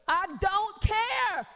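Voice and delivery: slow, dismissive I don't care. Retold or imitated. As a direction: brush it off slowly, unbothered. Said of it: "And I tell the person".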